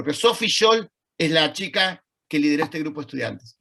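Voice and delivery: ordinary talk, nothing marked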